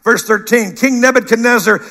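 ordinary talk, nothing marked

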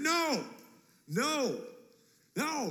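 high, forceful NO, each one set apart; emphatic no. Acted. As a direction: punch every "No" high and separately. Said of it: "Jesus is emphatic".

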